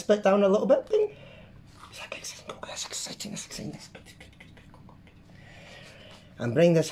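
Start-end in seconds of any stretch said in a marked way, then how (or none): 1.9-5.1 breathy excited whisper. Real, not acted. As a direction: hush it like a secret.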